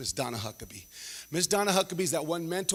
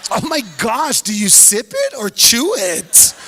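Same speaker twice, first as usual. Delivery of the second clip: louder, slower puzzled shriek; shocked question; 6 semitones higher, 10 dB louder, slower than usual. Retold or imitated. Tammy baffled by thick champurrado, loud and slow. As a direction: exclaim in disbelief at the thick drink. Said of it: "she's like".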